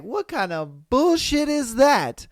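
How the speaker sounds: normal talking voice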